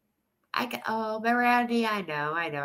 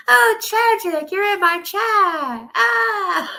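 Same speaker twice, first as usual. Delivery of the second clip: much higher, louder gushing squeal; fangirling; 9 semitones higher, 6 dB louder than usual. Real, not acted. Streamer greets a favourite viewer in chat, gushing excitedly. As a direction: squeal with delight over a fan. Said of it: "can I fangirl over tragic now".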